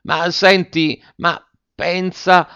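slow, drawn-out placating voice; trying in vain to calm two quarrelling women; soothing. A narrator's reading. The husband to the two women, slow and placating. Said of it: "la voce del collalto cercava invano di calmarle".

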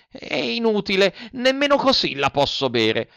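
put-on high-pitched childish refusal; whining Pinocchio. Puppet still refuses the glass, whining. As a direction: start high, sinking into a stubborn whine.

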